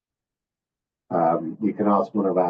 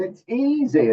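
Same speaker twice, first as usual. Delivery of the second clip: mock parent; 13 semitones higher than usual. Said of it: "the parents are saying".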